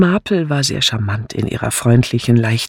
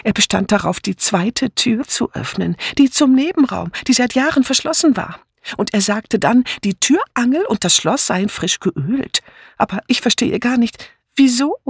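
much higher, fussy and breathless; flustered Dora Banner; 10 semitones higher than usual. A narrator's reading. Dora Banner blurting news to Letty, high and flustered. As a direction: chatter on, puzzled and excited.

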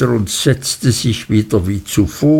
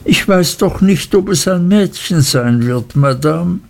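high, guileless answer; young mother innocently answering the aunt, pitched higher; 6 semitones higher than usual. A narrator's reading. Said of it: "sagte meine Mutter unschuldsvoll".

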